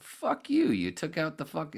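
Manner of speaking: Roseanne's high, indignant retort done as a mock impression; imitating Roseanne. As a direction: squeal it like her comeback. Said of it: "like she said in her retort".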